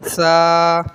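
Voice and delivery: lisped Arabic tha in "سا"; no whistle. Shown as a demonstration. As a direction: say "سا" thin with a held vowel. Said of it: "سا میں سیٹی نہیں نکالنی".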